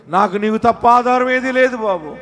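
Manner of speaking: acted desperate prayer; desperate plea. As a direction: plead loudly, as if praying for the congregation. Voicing a desperate prayer to God.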